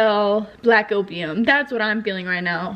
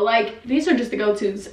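usual speaking voice

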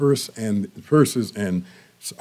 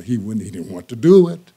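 ordinary delivery

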